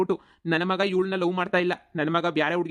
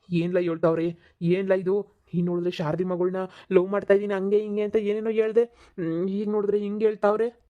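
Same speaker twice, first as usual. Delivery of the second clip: normal talking voice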